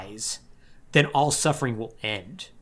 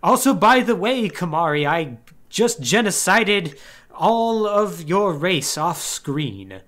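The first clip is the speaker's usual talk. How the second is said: smug Seymour; 4 semitones higher, slower than usual. Retold or imitated. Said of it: "Seymour's like".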